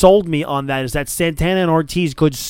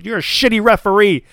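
higher mock sneer at the ref; sneering insult; 7 semitones higher, 3 dB louder than usual. Jericho glaring at the referee: mock sneer. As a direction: insult her to her face. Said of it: "looks her straight in the eye and goes".

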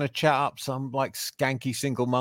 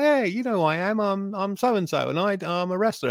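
higher, a mock-cocky wrestler introducing himself; 8 semitones higher than usual. Wrestler greeting a member of the public, mock-cocky and higher. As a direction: play a wrestler expecting to be recognised, lightly hammed. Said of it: "It's like".

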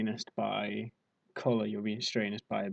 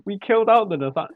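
laughing burst; 9 semitones higher, 11 dB louder than usual. Real, not acted. Bursts out laughing then speaks.

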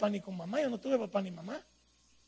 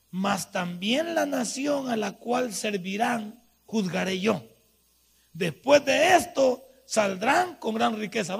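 slow, solemn reading of the verse; scripture reading; 7 dB louder, slower than usual. Acted. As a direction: read it out slowly and weightily. Reads the Bible verse.

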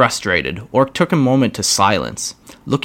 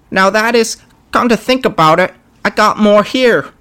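much higher squeaky goblin voice; a nervous goblin pleading with the boss; grot voice; 12 semitones higher, 4 dB louder than usual. Acted.

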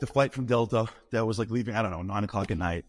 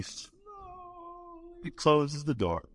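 ominous slow-down; 4 dB quieter, much slower than usual. A narrator's reading. Ominous suspense build.

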